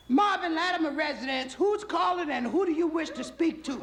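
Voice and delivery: very high falsetto housewife; shrill-pitched, prim phone-answering falsetto; wife on the phone. Acted. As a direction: put on a comic falsetto wife. Wife answers phone, comic character voice.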